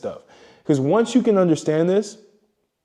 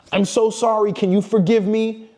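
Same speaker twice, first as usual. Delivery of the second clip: higher, pleading mock apology; mock groveling; 6 semitones higher than usual. Mocking a needy guy to his master, higher groveling plea. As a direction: beg forgiveness like a servant to a master.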